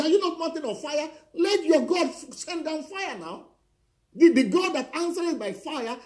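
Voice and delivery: high, loud, taunting challenge; a rising, roaring dare from the pulpit; preaching climax. Real, not acted. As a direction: dare him to call down fire, at full force. Preacher taunts rival to call fire.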